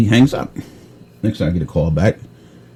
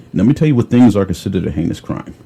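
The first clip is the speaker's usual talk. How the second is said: usual speaking voice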